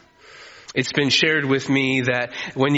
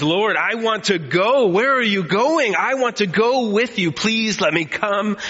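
put-on high, pleading childlike begging; eager little kid; 6 semitones higher than usual. Peter begging Jesus to come along, childlike pleading. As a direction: beg like a kid wanting to open presents. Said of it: "Sounds like a little kid on Christmas".

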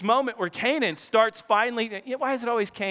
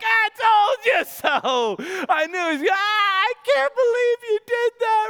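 high, loud, slow shouted gloat; dad gloating at son from the couch; gleeful teasing; 12 semitones higher, 4 dB louder than usual. Retold or imitated. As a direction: crow over him, laughing.

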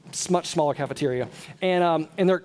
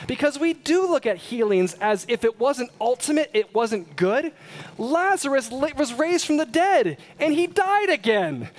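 higher, louder preaching at full force; 9 semitones higher, 3 dB louder than usual. Acted. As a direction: drive it home to the congregation.

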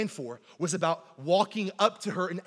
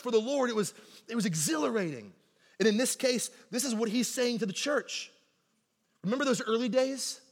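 ordinary talk

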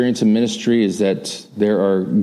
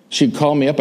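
usual speaking voice

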